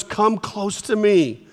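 un po' lento: vieni vicino, dolcemente; slow and gentle. Shown as a demonstration. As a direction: draw them in slowly. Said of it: "they're going about this fast".

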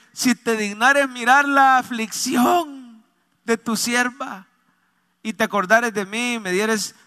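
stretched-out, mildly pained supplication; anguished prayer. Retold or imitated. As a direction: imitate an anguished plea, slowly. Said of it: "una oración con angustia".

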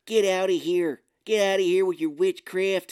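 put-on Chicago accent. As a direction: yell it like an irate Chicago local. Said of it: "it would be more like".

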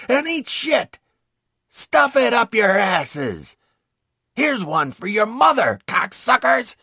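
high, loud screamed abuse; furious yelling. A narrator's reading. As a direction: vent fury at the enemy at full volume. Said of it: "I yelled at them until my throat went scratchy, venting my fury".

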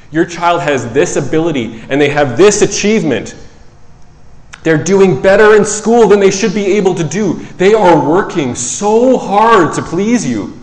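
harsh rebuke. Retold or imitated. Psychologist confronting parents, loud and pressing. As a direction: lay it on the parents firmly. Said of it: "I don't say it that harshly".

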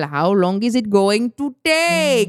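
put-on a light Indian accent, very slow. Imitating a funny TikTok sound in an Indian accent, very slow. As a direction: drawl the English line in an Indian accent. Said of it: "משהו במבטא הודי".

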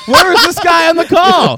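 high, loud cry of protest; comic outburst. Real, not acted. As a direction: call it out loudly over the laughter. Mock protest after the accent joke.

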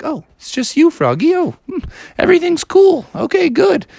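high cartoon mother voice; high-pitched mom, easing off; relieved mom. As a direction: settle down after a false alarm. Relieved mom finds only Froggy.

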